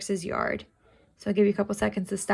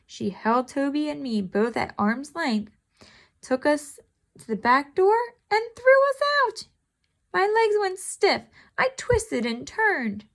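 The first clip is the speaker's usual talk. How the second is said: lively read-aloud, pitched higher than her chat; story narration; 5 semitones higher than usual. Acted. Teacher reading the cat story to her class, animated.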